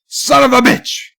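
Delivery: loud, high outburst; demonstrated shout. Shown as a demonstration. Streamer finishing a list of ways, shouting. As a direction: belt it out as a curse. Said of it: "how many different ways can you say".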